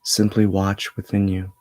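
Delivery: measured meditation voice with long pauses; steady, level tone, resting between phrases; guided meditation. Acted. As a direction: guide them inward in an even, unhurried flow. Leads a meditation.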